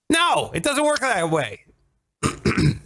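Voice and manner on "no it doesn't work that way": high-pitched exasperated outburst; yelling, angry. Real, not acted. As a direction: object heatedly, pitch jumping up. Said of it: "I'm yelling a lot".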